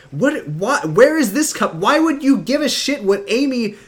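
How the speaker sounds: going off in disbelief; exasperated rant. Real, not acted. Ranting about Ricky's behavior.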